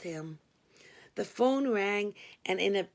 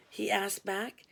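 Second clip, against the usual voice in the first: plain reading voice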